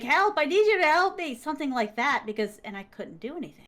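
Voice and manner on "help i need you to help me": imitating ghost's plea. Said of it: "like, like".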